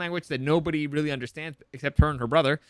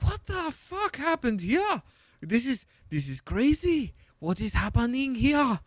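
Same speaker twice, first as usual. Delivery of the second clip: high, slow mock French accent; hammed-up French shock, drawn out; Frenchie; 9 semitones higher, slower than usual. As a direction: exclaim in a put-on French accent. Mimics Frenchie's accented shocked reaction.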